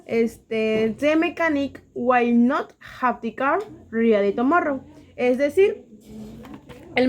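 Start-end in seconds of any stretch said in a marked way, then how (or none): 1.0-4.8 slow English example read. Shown as a demonstration. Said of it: "Sería".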